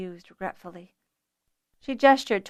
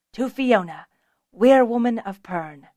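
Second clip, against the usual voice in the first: toast; 3 semitones higher, 9 dB louder than usual. Acted. Raising a toast.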